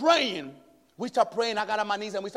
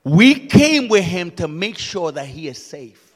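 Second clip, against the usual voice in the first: preaching shout; 16 dB louder than usual. Acted. Preacher urging the church to say don't fear, shouting. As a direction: rouse the congregation.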